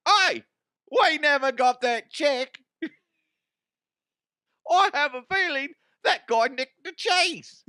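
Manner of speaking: very high, squeaky falsetto seller; comic cheese seller. Pretend. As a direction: answer eagerly in a silly falsetto. Seller realises the theft.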